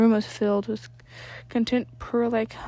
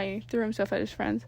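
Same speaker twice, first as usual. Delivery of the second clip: usual speaking voice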